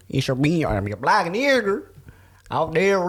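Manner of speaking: slow, high, hammed-up Southern accent; terrible Southern accent. Podcast co-host introducing himself on air in a bad Southern accent. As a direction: drag out a bad Southern drawl. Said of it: "you have a terrible Southern accent".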